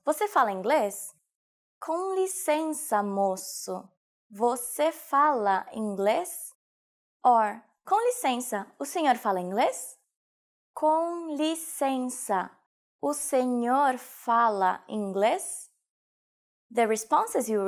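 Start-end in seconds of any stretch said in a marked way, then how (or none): 1.8-6.5 slow, measured syllables; slow teaching repetition. Shown as a demonstration. Teacher repeats sentence slowly. 10.8-15.7 very slow, stretched syllables; slow teaching repetition. Shown as a demonstration. Teacher repeats sentence slowly.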